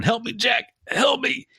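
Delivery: very high begging squeal; hammed-up high-pitched cry for help; desperate cry. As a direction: squeak out a hanged man's plea. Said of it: "Buck's still flopping around going".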